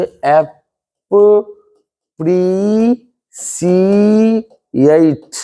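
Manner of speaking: अप्रिशिएट stretched very long, syllable by syllable; very slow demo pronunciation. Shown as a demonstration. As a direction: drag out अप्रिशिएट extremely slowly. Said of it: "clear समझिए". (बहुत धीमा, खिंचा उच्चारण)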